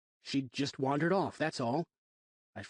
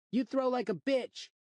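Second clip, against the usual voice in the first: put-on higher, slow sneering kid voice; a brat jeering at a bad throw; taunting kid; 13 semitones higher than usual.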